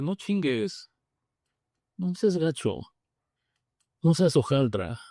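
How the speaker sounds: mild mock outburst at God; pleading demand to God. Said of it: "o le grité".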